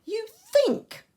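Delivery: "think" punched very high and loud; real emphasis. Shown as a demonstration. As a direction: exclaim it, hitting "think" hard at a high pitch. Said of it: "this is how I read it".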